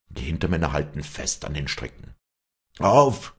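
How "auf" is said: loud, high groaning call of effort; a straining groan of "Auf!"; groaned effort cry. A narrator's reading. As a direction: heave it out with a groan. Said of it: "stöhnt's aus dem Munde".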